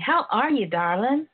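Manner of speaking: normal talking voice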